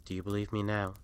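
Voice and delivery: ordinary delivery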